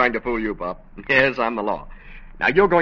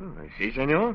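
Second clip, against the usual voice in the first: light put-on Spanish accent, a detective in disguise; a lawman faking a mild Spanish accent; phony Spanish accent; 5 dB quieter than usual. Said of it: "with that phony accent".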